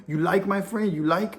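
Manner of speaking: lightly imitated Greek accent; poor Greek accent. Greek chief asking the visitor in the gym, lightly imitated Greek accent. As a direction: put on a mild, amateurish Greek accent. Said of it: "in my poor Greek voice".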